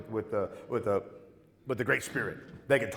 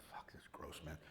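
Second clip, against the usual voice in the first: unvoiced whisper of disgust; muttered disgust; 7 semitones lower, 25 dB quieter than usual. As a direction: hiss it under your breath, grossed out. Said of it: "I'm like".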